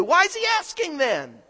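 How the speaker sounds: ordinary talk, nothing marked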